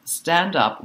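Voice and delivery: ordinary talk, nothing marked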